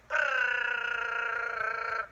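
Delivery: loud rolled brrr rattle; rattling noise. Retold or imitated. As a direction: buzz the lips like a rattling trap. Trap rattling under the bed.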